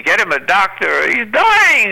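retold scream rising to a high, loud peak; imitated screaming. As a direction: yell it like a panicked onlooker. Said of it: "people were screaming".

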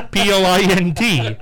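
ordinary talk, nothing marked

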